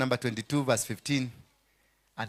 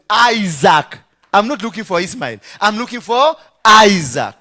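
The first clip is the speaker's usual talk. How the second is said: sarcastic God; 5 semitones higher, 13 dB louder than usual. Acted. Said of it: "That's sarcasm".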